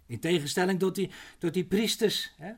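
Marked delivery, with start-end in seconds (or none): none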